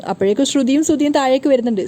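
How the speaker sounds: ordinary delivery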